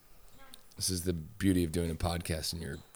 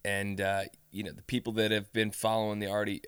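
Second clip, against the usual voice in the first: ordinary talk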